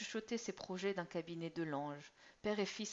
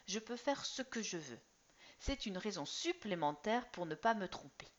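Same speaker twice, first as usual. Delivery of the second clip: higher, louder schoolboy making a speech; earnest teen son; 3 semitones higher, 5 dB louder than usual. Acted. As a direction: present your case like a little lawyer. Son voiced across many lines.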